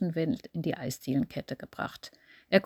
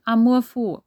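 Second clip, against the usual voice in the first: plain reading voice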